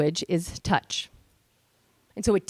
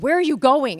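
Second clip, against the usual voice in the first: raised, louder yell at mom; calling after her, high and loud; retold yell; 5 semitones higher, 6 dB louder than usual. Teen son yelling after his mom, raised and loud. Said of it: "He actually yelled".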